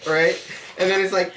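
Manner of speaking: ordinary talk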